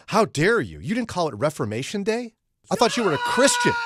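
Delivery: high, loud, indignant squeal rising to a shout; mock outraged caller. Retelling a listener's indignant text, in a hammed-up squealing shout. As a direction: ham up a scandalised caller. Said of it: "somebody else will go".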